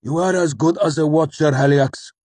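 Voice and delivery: high, loud outburst; angry snap. A narrator's reading. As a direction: snap it out defiantly at full voice. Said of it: "he snapped".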